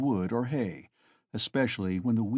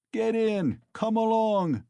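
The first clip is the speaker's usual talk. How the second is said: laughing call; 11 semitones higher, 4 dB louder, slower than usual. Acted. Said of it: "the crowd laughed".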